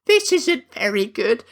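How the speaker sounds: high, slow scolding lady voice; mock-posh high disapproving friends; prissy friends. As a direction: tut at her like a proper Victorian lady. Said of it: "they're like".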